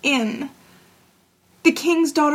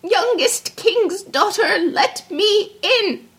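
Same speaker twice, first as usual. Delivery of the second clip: high, slow, drawn-out frog call; sing-out call of a frog at the door; crying out; 9 semitones higher, much slower than usual. A narrator's reading. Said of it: "and a voice crying".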